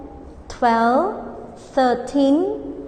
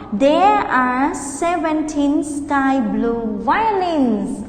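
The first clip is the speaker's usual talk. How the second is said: slow, clear model sentence; slow model answer. Shown as a demonstration. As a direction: pace it for the class. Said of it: "Okay, answer".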